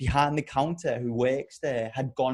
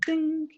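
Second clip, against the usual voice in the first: a high sung ding like a shop door bell; ping it out high like the bell over the door; imitated door bell. Telling his friend how the shop door chimed, a high imitated ding. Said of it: "the door clicks like a bell".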